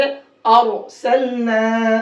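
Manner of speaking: very slow "अरसलना" with rounded start; heavy Ra. Shown as a demonstration. Teacher answering how to read it, heavy Ra. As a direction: thicken "अर", draw out the last vowel. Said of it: "कैसे पढ़ेंगे?".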